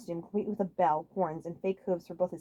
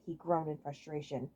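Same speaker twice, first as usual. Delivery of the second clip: ordinary delivery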